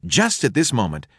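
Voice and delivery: ordinary delivery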